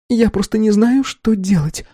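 high-pitched, helpless lament; tearful. A narrator's reading. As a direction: plead as if fighting back tears. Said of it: "не могла сдерживать слёзы".

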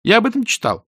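put-on high, know-it-all boy voice; cocksure youngster. A narrator's reading. Boy backs his claim to the wizard, cocksure. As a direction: pipe it up proudly.

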